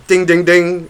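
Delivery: mimicking the coin counter; coin machine dings. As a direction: ring it like a machine.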